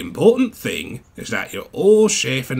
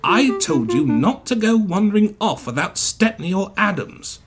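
raised-pitch, emphatic telling-off; cross reprimand, pitched up; scolding; 7 semitones higher, 4 dB louder than usual. A narrator's reading. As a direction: lecture them sternly. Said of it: "the Rail Tour manager scolded".

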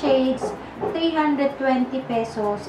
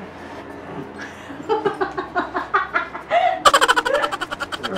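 shrieking laugh; 10 semitones higher than usual. Real, not acted. Laughing after her roar.

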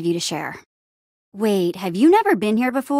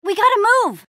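high, urgent call to flee; alarmed, high-pitched orders; urgent command; 10 semitones higher than usual. Acted. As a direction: hurry everyone to safety, voice raised. Urging companions to flee danger.

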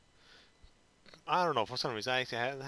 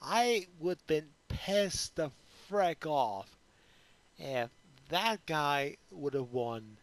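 salty drawl; slower than usual. Real, not acted. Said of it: "how salty I was".

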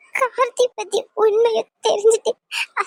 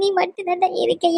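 normal talking voice

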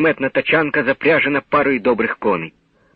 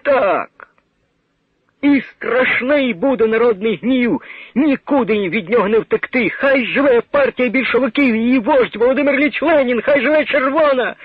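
defiant cry; 9 semitones higher than usual. Acted. Condemned man to the crowd, defiant high ringing cry. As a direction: fling the slogans up at the crowd.

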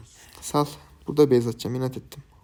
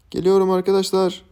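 usual speaking voice

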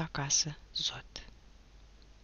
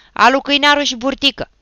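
high, very loud calling of names; commanding yell; shouted summons; 9 semitones higher, 13 dB louder, faster than usual. A narrator's reading. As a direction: call out the culprits by name. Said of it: "strigă atunci Achim".

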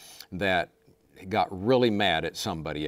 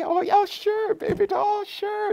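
put-on very high, sugary baby talk; sweet daddy to child; slower than usual. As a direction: coo at the little one like a doting dad. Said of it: "talking so sweet to the child".

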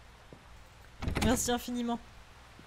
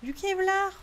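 repeated louder; 8 semitones higher, 4 dB louder than usual. Real, not acted.

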